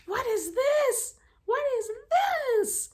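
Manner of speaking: very high, loud, slow wail; overwhelmed outburst. Retold or imitated. Reader reacting to one quote in a book, high dramatic wail. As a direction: wail each word in disbelief. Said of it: "I was like".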